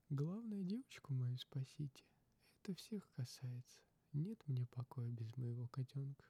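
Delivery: a hint of an anxious mother; pleading mother. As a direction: plead for your little girl.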